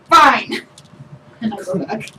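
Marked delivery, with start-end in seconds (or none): 0.1-0.7 loud, raised one-word outburst; huffy giving in. Acted. Player surrendering to the skeleton guard, loud and huffy. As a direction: give in with a loud huff.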